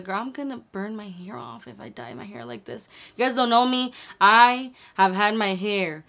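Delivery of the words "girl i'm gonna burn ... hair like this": quiet aside. Retold or imitated. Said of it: "and I was like".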